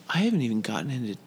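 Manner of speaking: ordinary talk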